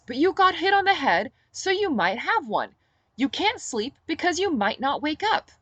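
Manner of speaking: a hint of fussing mother hen; Olivia voice. Olivia nagging about concussion.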